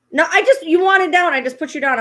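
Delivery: high, loud protest easing down; exasperated scolding. Real, not acted. Owner refusing to pick the dog back up, exasperated. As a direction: scold the pet loudly, pitch falling as it goes.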